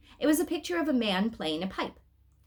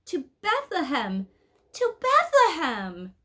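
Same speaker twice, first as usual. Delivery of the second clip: higher, slow, building to a loud tearful plea; a lost little girl begging; tearful cry; 9 semitones higher, 6 dB louder, slower than usual. A narrator's reading. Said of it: "sobbed Elizabeth".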